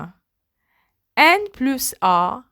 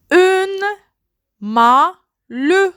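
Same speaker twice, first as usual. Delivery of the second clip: high "Une", then "malle" with a rising end; syllabified; 6 semitones higher, slower than usual. Shown as a demonstration. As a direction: break "Une malle" into two beats. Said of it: "word split into syllables". ("Une malle" détaché, voix haute sur "Une")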